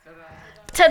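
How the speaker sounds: loud showy ta-da; big ta-da; ta-da shout. Shown as a demonstration. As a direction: belt it out like a showman. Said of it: "what can we do?".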